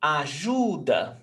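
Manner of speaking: "Ajuda" slow, with a long middle syllable; penultimate stress. Shown as a demonstration. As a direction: say "Ajuda" as a-JUU-da. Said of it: "before last".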